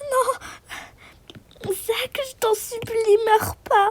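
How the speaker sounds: souffler en pleurant presque; supplication chuchotée. Acted. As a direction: implorer tout bas. Supplication au mourant. (femme suppliant l'ami mourant de tenir)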